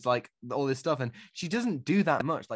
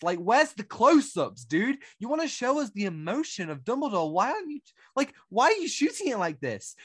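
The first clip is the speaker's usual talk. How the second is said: high-pitched, pleading exasperation; exasperated rant; 9 semitones higher than usual. Real, not acted. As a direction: complain at the director in rising disbelief.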